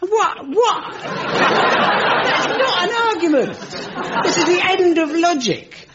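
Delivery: high, loud indignant disbelief; comic outrage; exasperated. Real, not acted. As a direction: sputter at the absurd logic. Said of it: "exasperated rant".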